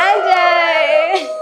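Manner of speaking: high, drawn-out call of the name; excited call. Real, not acted. Bride announces her maid of honour, excited call.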